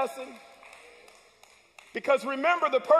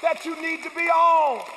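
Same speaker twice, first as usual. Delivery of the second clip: normal talking voice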